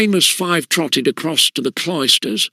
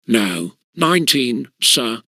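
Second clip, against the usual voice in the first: ordinary delivery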